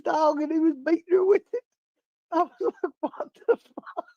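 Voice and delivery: very high voice, breaking into real laughter; cracking up. Real, not acted. Retelling the dead dog testimony to viewers, cracking up. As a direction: barely get the story out for laughing.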